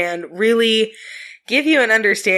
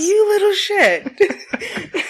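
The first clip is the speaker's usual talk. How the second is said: laughing; 12 semitones higher, much slower than usual. Real, not acted. Laughing at son.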